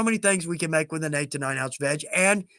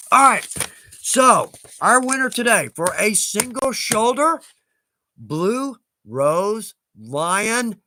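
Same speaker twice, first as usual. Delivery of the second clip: louder and higher, the name stretched out slowly; winner announcement; 4 semitones higher, 6 dB louder, slower than usual. Real, not acted. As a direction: build up and reveal the winner.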